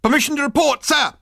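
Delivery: high, loud parade-ground call; military bark. Acted. Soldier asking his captain to report, barking. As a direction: bark it at attention.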